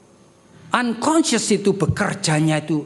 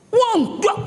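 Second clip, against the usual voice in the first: hasty denial; 13 semitones higher than usual. Acted. Peter denying, high far.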